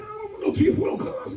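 high, shouted refusal; mock shout. Husband refuses the dealer's offer, shouting.

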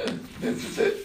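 weak, voiceless dying groan; dying gasp, acted out; fading away. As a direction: give it the last breath of someone fading. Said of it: "like, fading".

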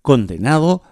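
brighter, livelier radio host read; radio announcer. Acted. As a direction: present the song like a DJ. Radio host introduces a song. (locutor de radio animado)

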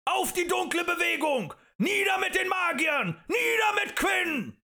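very high, loud battle cry; roaring slogans. A narrator's reading. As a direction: bellow it like a riled-up mob. Crowd roars rallying slogans.

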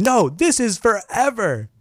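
high, slow, hammed-up vow; dramatic mock vow. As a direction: overplay it as a solemn vow. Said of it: "And she said".

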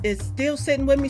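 ordinary talk, nothing marked

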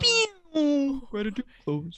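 jingle-like sound effect voice; tinny sound button clip. Acted. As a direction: announce it like a recorded button. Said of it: "sound button that goes".

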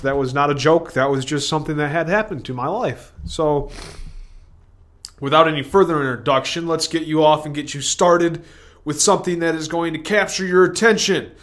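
ordinary talk

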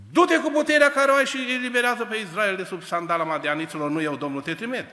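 loud, high commission; commanding angel. Acted. Angel sending Gedeon against Midian, loud. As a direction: send him off with loud authority.

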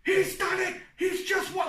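high-pitched, amped-up lift call; thrilled sportscaster crying out in amazement; excited commentary. Acted. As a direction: call it like you're on your feet. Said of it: "I was standing up, being like".

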